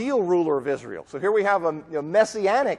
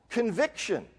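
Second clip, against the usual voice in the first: normal talking voice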